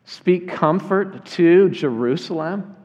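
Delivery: slow question with a rising end; surprised questioning. Acted. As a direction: ask it as if unexpected. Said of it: "This is a totally unexpected transition".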